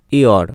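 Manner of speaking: "ইয়র" opened with a y-glide; y as iyo. Shown as a demonstration. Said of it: "উচ্চারণ হবে ইয়র".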